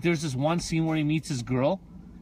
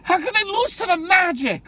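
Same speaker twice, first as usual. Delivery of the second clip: high, loud cry of disbelief; upset; 12 semitones higher, 5 dB louder than usual. Real, not acted. Fan laments the loss to the Magic, high upset outcry. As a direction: protest the loss at full pitch. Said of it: "it just makes me so upset".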